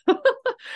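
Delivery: sudden high, bright laugh; high burst of laughter. Real, not acted. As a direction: let a squealing laugh out. Laugh alone on a line.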